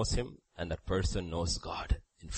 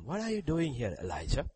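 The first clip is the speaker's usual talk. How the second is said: still small voice; 3 semitones higher, 6 dB quieter than usual. Acted. God questions Elijah at the cave, still small voice. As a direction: ask it very softly, intimately. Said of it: "a still small voice".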